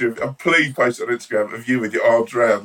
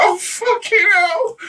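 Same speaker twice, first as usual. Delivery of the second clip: high, slow delighted shriek; excited squeal; much slower than usual. Pretend. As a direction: squeal with starstruck joy. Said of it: "and you're like".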